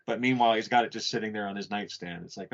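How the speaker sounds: usual speaking voice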